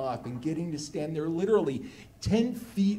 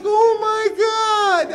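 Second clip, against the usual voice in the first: slow, much higher and louder gasp of awe; thrilled shout; 12 dB louder, much slower than usual. Retold or imitated. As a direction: scream it like a starstruck fan. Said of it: "And I'm like".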